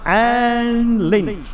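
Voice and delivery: big drawn-out announcer voice; stadium announcer. Acted. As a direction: present each name to the crowd. Announcer calls names.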